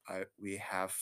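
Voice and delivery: low, very soft, understated delivery; hushed and low, play-acting seriousness; imitated solemn character. Ruriko voiced in retelling.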